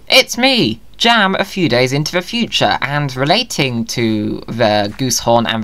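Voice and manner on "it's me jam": high greeting. Acted.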